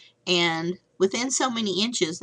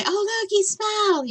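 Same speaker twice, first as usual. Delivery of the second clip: delighted parent; 14 semitones higher than usual. Retold or imitated. Parents cooing at baby.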